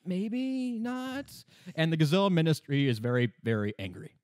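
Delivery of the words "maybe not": high, soft, tentative squeak; mock-sheepish doubt; hesitant objection. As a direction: hedge it timidly, pitched way up. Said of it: "Miang, however, says".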